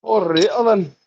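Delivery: put-on Scottish accent with a falling then; a light Scottish accent. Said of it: "a better Scottish accent".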